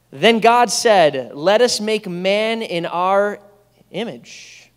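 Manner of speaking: loud, slow, proclaiming reading of scripture. Acted. Pastor reading Genesis aloud to the congregation, loud and slow. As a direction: proclaim the verses to the whole room.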